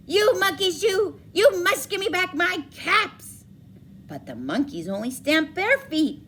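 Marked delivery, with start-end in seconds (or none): 0.1-3.5 loud, higher shout; shouting peddler. Acted. Furious peddler shouting at the monkeys for his caps. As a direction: stamp and shout it. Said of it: "and he shouted".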